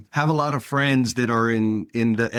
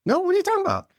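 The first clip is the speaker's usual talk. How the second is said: incredulous denial; much faster than usual. Retold or imitated. Retelling her answer when a friend asks if she's mad, incredulous and much higher. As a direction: pitch leaps way up, baffled and defensive.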